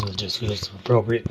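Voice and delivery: ordinary talk, nothing marked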